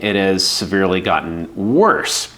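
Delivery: normal talking voice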